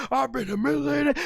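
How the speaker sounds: imitating furious Stone Cold; mad Stone Cold. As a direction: seethe it, hammed-up. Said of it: "the way Austin was mad".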